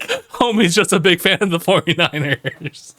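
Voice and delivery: high-pitched, drawn-out joking quote; amused mock quote. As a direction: quote the joke, breaking into laughter at the end. Quoting someone's joke while laughing.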